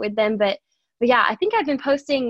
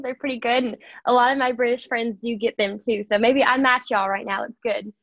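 ordinary talk

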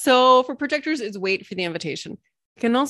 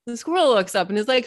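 normal talking voice

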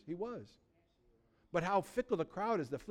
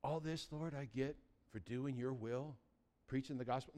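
quiet mock complaint; 4 semitones lower, 10 dB quieter than usual. Imagined Paul complaining in jail.

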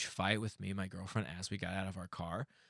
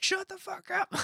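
high-pitched mock yell; yelling neighbors. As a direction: imitate irritated neighbors hollering. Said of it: "they would yell and stuff".

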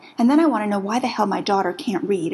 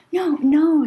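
quick reassurance; 8 semitones higher, 5 dB louder than usual. Acted. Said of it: "she says".

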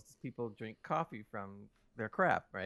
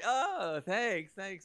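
fake polite thanks; 6 semitones higher, much slower than usual. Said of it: "to be nice and polite".

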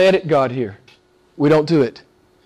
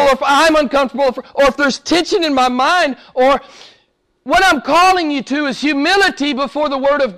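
raised, insistent preacher's voice; fervent preaching; 7 semitones higher than usual. Acted. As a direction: press the point hard on the congregation. Preaching at full force.

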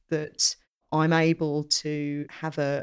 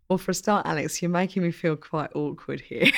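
ordinary delivery

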